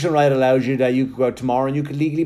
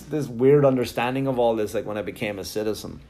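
normal talking voice

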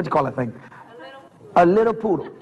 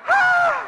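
dog scream. Retold or imitated.

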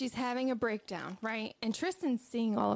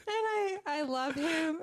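tearful; 5 semitones higher, slower than usual. Real, not acted. Moved telling son's words.

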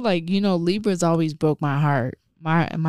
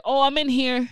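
retold call; 7 semitones higher than usual. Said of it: "I was like".

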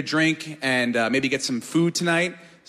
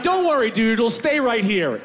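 higher pitched; 7 semitones higher, 3 dB louder than usual. Retold or imitated. Drunk reassuring buddy.

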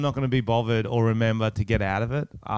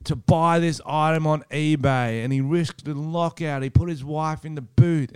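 slow reading aloud; 4 semitones higher, slower than usual. Retold or imitated. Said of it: "reading the article".

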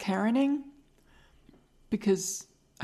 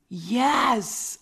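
louder, stretched YES; excited retold exclamation; 3 semitones higher, 5 dB louder than usual. Host retelling colleague's reaction, excited drawn-out yes. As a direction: cry yes in delighted recognition. Colleague delighted at recognized impression.

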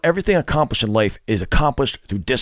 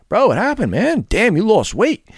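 higher, louder surprised bro voice; imitating bro friends; 10 semitones higher, 3 dB louder than usual. Friends noticing his weight loss, mock surprised bro. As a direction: sound like a buddy who is shocked.

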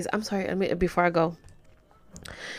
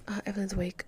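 hushed aside so as not to disturb; whisper; 9 dB quieter, faster than usual. Real, not acted. Noticing the baby Evelyn is awake, in a whisper.

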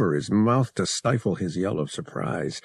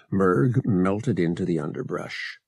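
ordinary delivery, nothing marked

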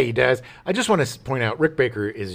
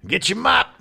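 bossy supervisor; 4 semitones higher than usual. Retold or imitated. Voicing a boss on set.

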